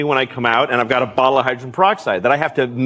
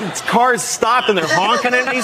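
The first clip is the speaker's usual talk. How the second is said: ordinary talk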